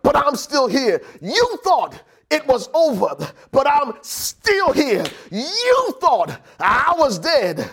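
high, driving pulpit declaration; rally the congregation in defiant, rising bursts; impassioned preacher, stretching the key words; full-force preaching. Real, not acted.

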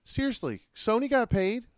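high, loud sudden exclamation; excited outburst. Real, not acted. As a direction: burst out about Sony getting paid. Mock outrage at Sony.

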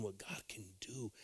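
hushed, breathy whisper to the congregation; whispered question. Real, not acted. Preacher whispering to the congregation about God, hushed. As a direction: lean in and whisper it.

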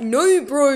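mock the accent on "No, bro"; mimicked ethnic no. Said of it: "everyone was like".

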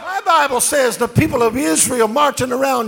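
loud, rising sermon shout over music; loud gospel preaching; full-force preaching. Acted. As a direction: preach it big to the whole church.

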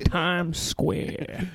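put-on slow, drawn-out folksy old man; old man's accent. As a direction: play a drawling old man lost in the city. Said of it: "what's up with that old man's accent?".

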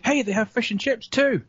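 high, eager shout; excited. Real, not acted. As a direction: burst out with excitement. Excited about the menu.

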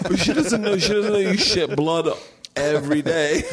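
high, laughing through the jab. Real, not acted. Teasing his friend about blood, laughing. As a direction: tease him while cracking up.

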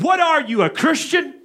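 imitating a scoffing challenge; scornful professor. Professor mocking retort.

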